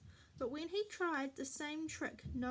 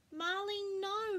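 mild yell; 7 semitones higher, 4 dB louder than usual. A narrator's reading. Mummy catches Marley misbehaving, mild yell. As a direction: yell no at the dog, held back. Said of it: "Mummy yelled".

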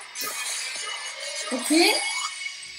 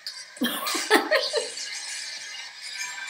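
stifled giggles; 8 semitones higher, 8 dB quieter than usual. Real, not acted. Laughing at silly video.

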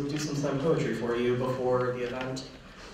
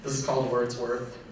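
ordinary talk, nothing marked

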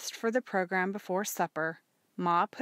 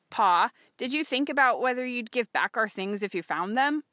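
put-on higher, louder boy's question; lightly voiced son; 5 semitones higher, 6 dB louder than usual. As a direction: ask Pa, a touch of boyishness.

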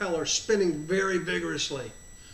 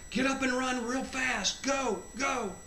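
mildly acted send-off, pitched higher; urging; 5 semitones higher than usual. Jesus sends his child off running, urging. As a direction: urge a runner on without shouting. Retelling Jesus sending him off.

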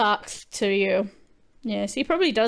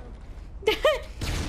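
breathy giggle; 11 semitones higher, 7 dB quieter than usual. Real, not acted. Laugh after own joke.

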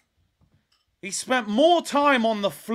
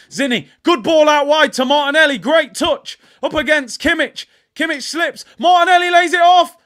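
loud, high, rising excitement as the attack builds; excited commentary; 8 semitones higher, 10 dB louder than usual. Real, not acted. Fan yelling the attack at his stream. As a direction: call the break like it's a chance.

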